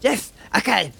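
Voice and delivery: high, hurried character voice; flustered compliance. Acted. Boris jumps to Z's order, flustered.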